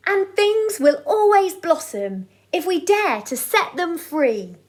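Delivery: put-on lively rhyming read-aloud to children; bouncy sing-song storyteller; storybook reading. A narrator's reading. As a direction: read it like a bedtime picture book for the boys. Reads rhyming picture book aloud.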